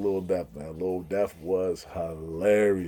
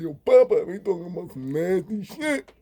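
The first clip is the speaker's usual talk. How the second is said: mimicking Habao; 9 semitones higher than usual. Deaf inmate Habao reporting overheard talk, high mock deaf voice. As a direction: gossip it high like a deaf man. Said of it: "He like".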